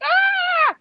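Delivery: piercing high scream of fright. Retold or imitated. Child in the back seat screaming at a hornet. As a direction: shriek like a scared kid. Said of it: "I started screaming".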